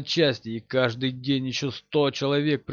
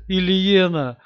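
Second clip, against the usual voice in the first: louder, higher, calling her name in despair; anguished cry; 6 semitones higher, 5 dB louder, slower than usual. Acted. Hero cries wife's name.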